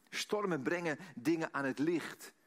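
ordinary talk

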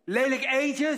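high, loud, cutting jeer; sneering taunt. Acted. Old woman taunting her reflection, sneering loudly. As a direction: spit it at the mirror. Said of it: "zegt mevrouw de Wit tegen de spiegel".

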